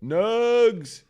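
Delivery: high, drawn-out neighbor holler; imitated yell. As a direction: call it out loud, stretching the words. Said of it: "he was just yelling from his lower apartment".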